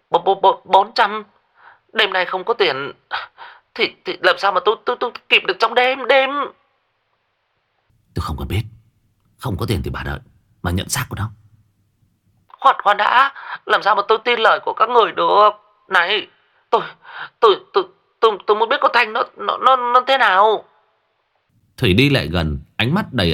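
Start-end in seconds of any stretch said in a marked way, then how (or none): 0.1-6.3 high, fast, stuttering alarm; frantic stuttering aunt; panicked stammer. Acted. 8.1-11.3 low, soft, menacing rasp; cold threat under a disguised voice; faked hoarse voice. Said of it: "giả giọng khàn khàn". 12.6-20.6 high, fast, stuttering alarm; frantic stuttering aunt; panicked stammer. Acted.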